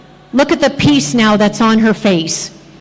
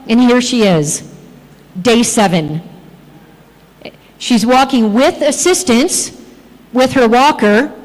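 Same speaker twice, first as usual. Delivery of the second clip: ordinary delivery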